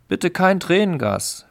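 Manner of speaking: screamed plea. Acted. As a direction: shriek it in panic. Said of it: "schrie jemand".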